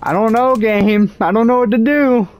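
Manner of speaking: high, loud exasperated whine; frustrated outburst. Real, not acted. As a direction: vent at the game.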